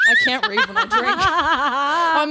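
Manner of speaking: tipsy giggling through words. Real, not acted. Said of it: "I'm getting drunk".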